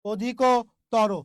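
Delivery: অধিকতর drawn out, "odhi-kooo... toro"; slow pronunciation demo. Shown as a demonstration. Teacher repeating the word for students, অধিকতর slow and split. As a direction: split অধিকতর after a lengthened ko. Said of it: "proper উচ্চারণটা এইটা ভাইয়া".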